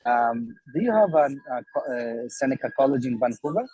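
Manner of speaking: talking over bus noise; phoning in from a moving bus; on a crowded bus. Real, not acted. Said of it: "I'm on the bus right now".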